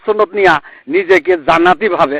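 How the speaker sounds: ordinary delivery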